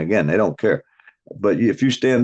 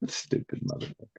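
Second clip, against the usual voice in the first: scornful words muttered under the breath, much softer; muttered insult; 15 dB quieter than usual. Real, not acted. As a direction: mutter it to yourself in disgust. Scorn for the man petting turds.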